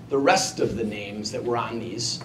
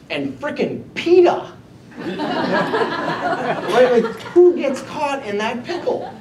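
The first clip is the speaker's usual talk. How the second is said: laughing; 8 semitones higher than usual. Real, not acted. Laughing at his own predicament.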